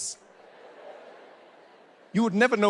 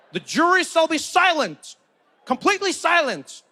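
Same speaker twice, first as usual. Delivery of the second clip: commanding judge; 8 semitones higher than usual. Acted. Judge ordering the jury silent, commanding. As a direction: lay down the law, pitched up high.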